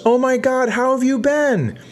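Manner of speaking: very high, gushing squeal of a reunion; high-pitched excited greeting between old acquaintances; gushing customer. Retold or imitated. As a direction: squeal it way up like bumping into an old friend. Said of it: "the lady customer ... goes".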